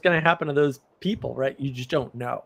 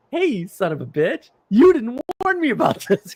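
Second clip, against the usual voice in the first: furious; 8 semitones higher, 4 dB louder than usual. Retold or imitated. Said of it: "'cause I was furious".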